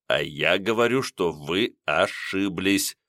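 ниже и тише, медленно, твёрдо; осадить холодно, давя на «вы»; сурово. A narrator's reading. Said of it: "сурово пресёк". (Леонтий сурово обрывает кондукторшу)

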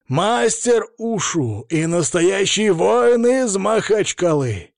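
slow, swelling fight introduction; milk every word for drama, then belt the surname; dramatic ring announcer. A narrator's reading. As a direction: build to a high, loud, stretched-out name. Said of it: "слегка повысив голос и добавляя драматичности".

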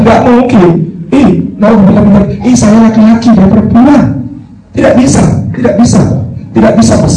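high, agitated refusal; flustered refusal. Acted. Hermit refusing to guard the girl, high flustered no. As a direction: wave them off in a panic.

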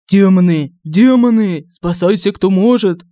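loud panicked fear shout; крик ужаса. A narrator's reading. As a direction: scream it while running away in terror. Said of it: "с истошным криком". (истошный крик от страха)

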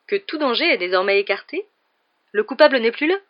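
high, loud patronising emphasis; condescending. A narrator's reading. Lockhart patronises the class, loud and high. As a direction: talk down, rising with self-satisfaction. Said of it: "comme s'il s'adressait à des demeurés".

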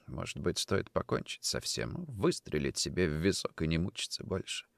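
low, very soft brooding; despairing thought. Acted. Nikitin alone with his pistol, hushed despair. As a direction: think aloud, hushed and hopeless. Despairing man considers suicide.

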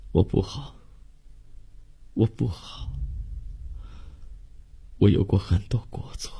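hushed, half-whispered plea with long pauses; tearful, tender confession. Acted. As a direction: beg a lover's forgiveness under the breath. Lovers' tearful plea for forgiveness. (含泪低语，气声恳求原谅)

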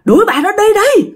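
very high, loud panicked shouting; father hiding behind the well howling to drive her out. Retold or imitated. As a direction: howl it from hiding, terrified. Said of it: "mà ba gào lên".

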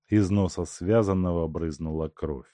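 usual speaking voice

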